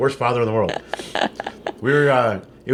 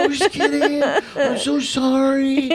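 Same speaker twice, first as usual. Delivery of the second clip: high, drawn-out mock wail; retold crying apology; 11 semitones higher, much slower than usual. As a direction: imitate a tearful, desperate sorry. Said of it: "And I'm crying".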